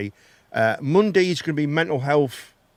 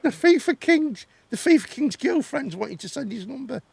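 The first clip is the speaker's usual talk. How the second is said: mock outrage; 6 semitones higher than usual.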